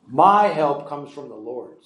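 "My help comes from the Lord" slow and settled, falling at the end; statement intonation. Shown as a demonstration. Preacher answering the psalm's question, "Lord" falling as a statement.

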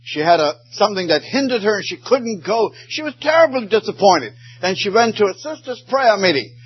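high fervent storytelling; preacher's rising plea; impassioned preaching. Acted. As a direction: carry the story up with fervor. Preaching climax, story of Pollard.

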